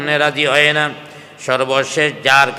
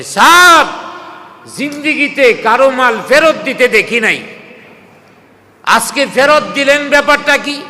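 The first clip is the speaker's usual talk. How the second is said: high, raised-voice disbelief; astonished questioning; 10 semitones higher, 6 dB louder than usual. Retold or imitated. Small-time followers questioning Abu Jahl, astonished high voice. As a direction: question the boss in loud amazement. Baffled henchmen question their boss.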